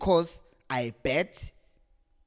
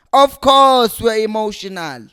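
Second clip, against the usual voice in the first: louder, higher, pressing it again; louder insistence; 5 semitones higher, 10 dB louder than usual. Real, not acted. Fan repeating himself to the chairman, louder. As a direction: hammer it home harder. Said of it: "I'm already emotional".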